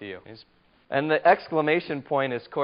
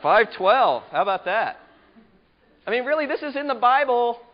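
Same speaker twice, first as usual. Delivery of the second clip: emphatic; 9 semitones higher, 7 dB louder than usual. Real, not acted. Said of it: "But feel that emotion".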